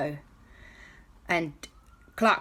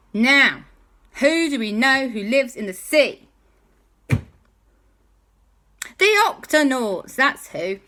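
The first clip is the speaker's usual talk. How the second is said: high, loud teasing buildup; excited storyteller; 6 semitones higher, 7 dB louder than usual. A narrator's reading. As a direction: build suspense for the kids. Storyteller teases next characters.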